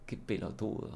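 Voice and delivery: soft, low "Qué pelotudo", breathed out; quiet deadpan put-down; unimpressed murmur. Shown as a demonstration. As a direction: say it with tired disdain. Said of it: "Ahí es cuando entra el".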